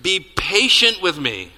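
loud, slow, high plea; begging. A narrator's reading. Fellow servant on his knees to creditor, begging. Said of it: "fell to his knees and begged him".

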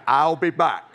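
put-on Arnold's Austrian-accented movie catchphrase. Preacher quotes the Terminator to the congregation, Austrian accent. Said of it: "in his Austrian, uh, accent".

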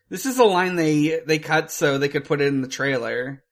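normal talking voice

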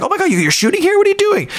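excited honeymooners. Retold or imitated. Newlyweds spotting the host in Florence, high thrilled. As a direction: squeal with delight at the surprise. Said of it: "they're like".

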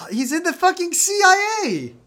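high, loud exasperated outburst; outraged disbelief. Real, not acted. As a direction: yell it in disbelief. Disbelief at plot twist.

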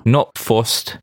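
lightly done British accent on Not fussed; a friend tries out British slang in a mild British accent. Shown as a demonstration. Podcast friend tries a British phrase, mild British accent. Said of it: "please turn to a British accent".

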